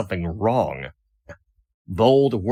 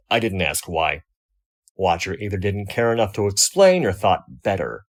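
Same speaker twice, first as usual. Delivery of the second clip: ordinary delivery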